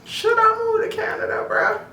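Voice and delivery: high, far-off musing voice; daydreaming about moving to Canada, voiced high; inner thought. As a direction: wonder it aloud in a high, put-on voice. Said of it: "sitting there thinking about".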